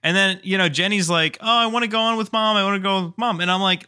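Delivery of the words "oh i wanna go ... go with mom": put-on high, fast little girl begging; a girl pleading to ride with mom; whiny kid. Niece quoted whining for mom.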